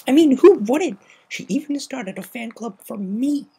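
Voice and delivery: high, loud, boastful brag; smug bragging; preening. A narrator's reading. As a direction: show off to your friend. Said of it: "smiling a preening little".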